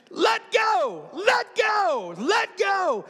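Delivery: very high, loud drawn-out shout; instructor's shouted order. Retold or imitated. Instructor to the clinging skydiver, acted shouted order. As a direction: yell it like an impatient command over wind. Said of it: "instructor is just staring at me going".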